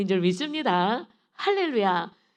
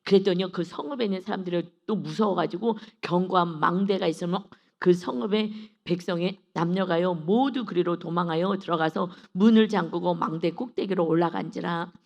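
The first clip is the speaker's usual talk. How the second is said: loud, declaiming Bible reading; scripture reading; 2 semitones lower, 6 dB louder than usual. Acted. Preacher reads Judges aloud to the church, declaiming. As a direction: read the passage out to the congregation.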